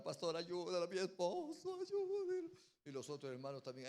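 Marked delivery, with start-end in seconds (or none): 0.0-2.5 pleading wife. Retold or imitated. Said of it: "su esposa se puso a llorar".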